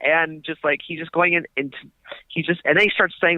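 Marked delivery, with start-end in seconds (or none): none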